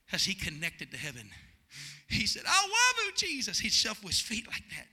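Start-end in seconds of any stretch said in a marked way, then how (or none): 2.4-3.6 higher, louder cry of love; burst of prayer. Retold or imitated. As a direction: let it burst out with the power. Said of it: "here came the power of God".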